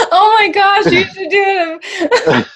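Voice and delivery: high laughing delight. Real, not acted. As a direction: squeal through laughter. Laughing at impression.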